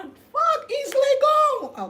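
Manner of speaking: high, slow, drawn-out curse; worked-up parting shot; exasperated venting. Real, not acted. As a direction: stretch it out in high-pitched exasperation. Venting at the end of rant.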